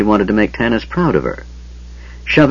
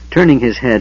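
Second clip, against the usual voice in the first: plain reading voice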